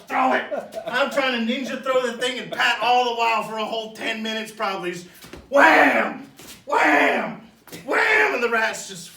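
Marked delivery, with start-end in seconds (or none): 5.5-8.3 loud high whams falling each time; repeated whack sounds. Retold or imitated. Imitating Pat's pitchfork blows, loud repeated whams. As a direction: beat out the blows one by one.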